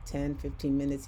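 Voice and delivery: ordinary delivery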